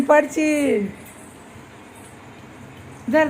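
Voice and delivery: normal talking voice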